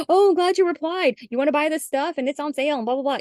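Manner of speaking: hammed-up gushing salesperson; mocking eager seller. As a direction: parody an overeager sales push. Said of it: "You don't be like".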